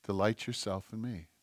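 calm them with a quiet, low reply; soft voice of God. Acted. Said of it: "And God goes".

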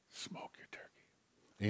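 slow, breathy secret whisper; whispered aside. Acted.

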